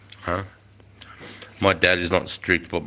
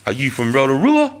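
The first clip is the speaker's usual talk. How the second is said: a hint of a Kiwi accent, a bit higher, Rotorua said the New Zealand way; 5 semitones higher, 4 dB louder than usual. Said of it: "Do you Kiwi accent?".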